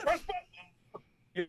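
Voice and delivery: very high, hushed, drawn-out mock squeal; mock awe. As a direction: stretch out a tiny high imitation of amazement. Mimicking people's excited reaction to video.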